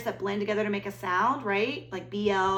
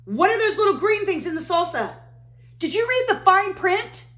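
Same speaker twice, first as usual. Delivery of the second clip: alarmed discovery; 10 semitones higher, 7 dB louder than usual. Acted. Alarmed discovery while reading aloud.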